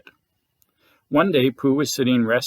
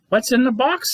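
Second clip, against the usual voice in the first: curious eager question; 9 semitones higher than usual. Acted. Hungry Pooh eyes lunchbox.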